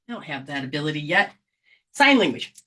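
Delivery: ordinary delivery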